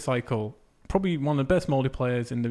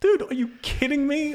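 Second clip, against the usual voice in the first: much higher, disbelieving cry; incredulous outburst; 13 semitones higher than usual. Retold or imitated. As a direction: squeal it in total disbelief. Exasperated retelling, pitch jump.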